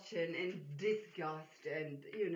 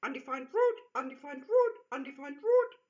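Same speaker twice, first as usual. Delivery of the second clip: higher, louder mechanical repeated call; broken cuckoo announcer; 7 semitones higher, 9 dB louder than usual. Acted. Half-broken cuckoo clock announcing to the room, mechanical. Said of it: "cuckoo don't stop announcing".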